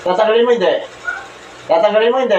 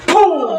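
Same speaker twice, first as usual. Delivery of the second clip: a pitched-up, punchy "boom" like a blast from heaven; mock explosion; 9 semitones higher than usual. Real, not acted. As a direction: burst out with the sound of a thunderclap. Healer strikes the spirit.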